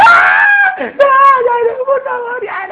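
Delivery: high, loud mock-crying plea; crying child begging. Child begging the parent to stop before the spanking, hammed-up crying. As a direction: wail like a kid begging not to be spanked.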